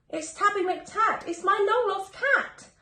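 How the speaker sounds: high, loud joyful cry; ecstatic. Acted. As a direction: shout with delight at finding him. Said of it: "Old Fred was ecstatically glad".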